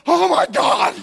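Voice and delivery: loud, high startled cry; a customer stunned by his barber's preaching; shocked outburst. Retold or imitated. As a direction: blurt it out in alarm. Shocked reaction to barber's vision.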